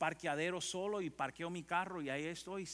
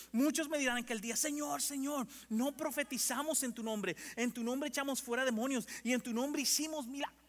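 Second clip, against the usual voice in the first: high, loud preaching cry; fiery preaching; 9 semitones higher, 4 dB louder than usual. Acted. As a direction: preach it out at full force to the congregation. Preaching builds to full force.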